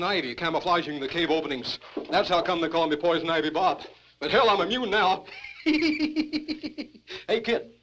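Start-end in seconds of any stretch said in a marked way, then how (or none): 5.3-6.8 high wheezing hobo cackle. Acted. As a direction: cackle at your own joke. Hobo laughs at own joke.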